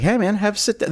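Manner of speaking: lightly voiced laid-back thug; a hint of Drexel. Drexel invites Clarence to sit, lightly voiced.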